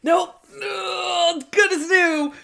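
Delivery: way up high, drawn out at the end; high squeaky mock voice. Podcaster imitating the guy in a high squeak. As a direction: squeal it like a giddy cartoon. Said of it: "He's like".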